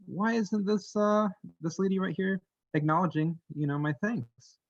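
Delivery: high, indignant fairy complaint; offended fairy. Acted. Fairy upset at the doll.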